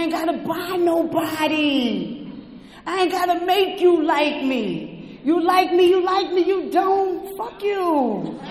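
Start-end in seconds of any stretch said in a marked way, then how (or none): 2.8-8.4 higher, punchy, don't-care attitude; mock cocky bravado; defiant swagger. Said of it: "I got to say it like that".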